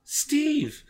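high, startled gasp of a name; acted gasp of surprise. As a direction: catch it high in shock. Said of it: "she gasped".

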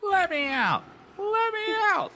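very high, squeaky angry call; high-pitched angry voice. A narrator's reading. Dummy trapped in the closet, high angry cry. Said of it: "the high-pitched voice cra-, called angrily".

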